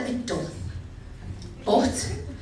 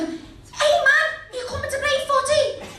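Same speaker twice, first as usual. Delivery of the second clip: high, loud taunting lad voice; jeering kids, high and loud; mocking lads; 4 dB louder than usual. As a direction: mimic the cruel lads calling out, pitched way up. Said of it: "they turn round to me and they go".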